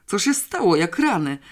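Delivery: irritated outburst. A narrator's reading. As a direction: demand what's going on, annoyed. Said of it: "zdenerwował się". (zdenerwowany, głośniejszy okrzyk)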